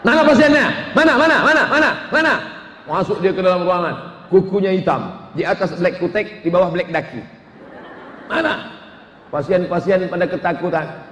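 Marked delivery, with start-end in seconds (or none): 0.1-2.4 high, loud brash calling out; hammed-up brash young doctor searching; mock funky doctor. Funky doctor looking for patient. 8.3-8.8 mock funky doctor.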